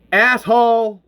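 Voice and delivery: loud, high curse; angry shout. A narrator's reading. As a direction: yell it at the creature you just killed. Hank curses the dead creature.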